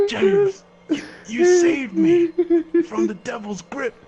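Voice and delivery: loud, high, hammed-up gasping thanks; overwrought gratitude. Patient back to life thanking James, melodramatic relief. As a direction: stammer it out high, as if just revived.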